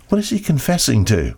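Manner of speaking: ordinary talk, nothing marked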